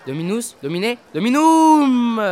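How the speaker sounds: slow, loud, high chanted Latin; pompous Latin declaiming. Acted.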